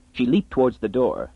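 ordinary delivery, nothing marked

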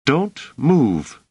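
high, tense command; threatening woman. A narrator's reading. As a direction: warn him at knifepoint. Woman holds knife at his throat.

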